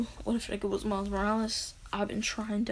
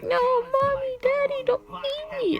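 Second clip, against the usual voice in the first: put-on high slow child plea; crying little girl; 4 dB louder than usual. Daughter begging her dying parents, high slow child voice. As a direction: plead like a small scared girl. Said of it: "she's like".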